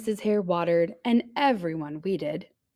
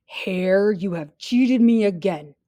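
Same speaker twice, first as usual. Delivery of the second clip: louder, aggrieved grumble; mildly angry; scowling bear; 5 dB louder than usual. Acted. Cheated bear scowling at hare, angry.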